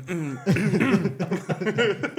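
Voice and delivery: sputtering cough from choking; coughing fit, choking on a drink. Real, not acted. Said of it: "pardon, je m'étouffe".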